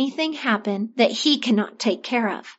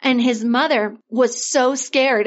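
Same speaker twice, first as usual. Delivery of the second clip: plain reading voice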